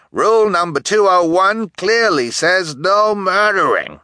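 high, loud rule-quoting townsman; indignant shout, finger-wagging; outraged accusation. A narrator's reading. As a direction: scold them loudly and righteously.

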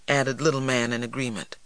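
plain reading voice

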